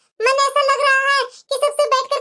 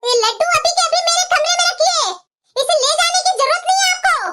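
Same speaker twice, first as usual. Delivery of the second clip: raised, higher-pitched indignant voice; angry scolding; 4 semitones higher than usual. Acted. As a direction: confront her over something taken from you. Daughter-in-law scolds over taken laddus. (गुस्से में ऊँची आवाज़ में डाँटते हुए)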